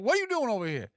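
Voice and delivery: high, playful animal voice; high-pitched innocent animal imitation; innocent pet dub. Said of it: "he's just like".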